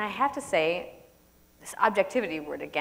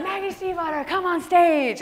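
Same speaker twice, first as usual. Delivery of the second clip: MC announcement; 10 semitones higher than usual. Retold or imitated. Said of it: "And they're like".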